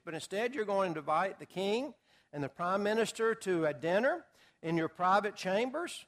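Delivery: normal talking voice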